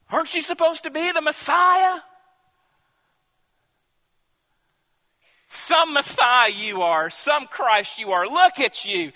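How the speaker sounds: loud, high insults, peaking in a shout; the criminal on the cross shouting insults; railing criminal. Acted. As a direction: hurl contempt at the one beside you. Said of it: "railed at him, hurled insults".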